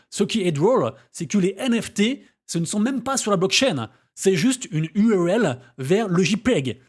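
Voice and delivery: badly faked English-speaker's accent in French; Elon Musk accent. Vlogger quoting what Elon Musk said about NFTs, in a bad anglophone accent. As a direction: put on a rotten anglophone accent, slowing down at the end. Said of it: "je vais prendre l'accent".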